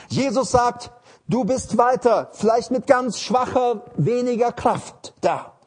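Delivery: full-force pulpit delivery; fiery preaching. Acted. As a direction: preach it out to the congregation.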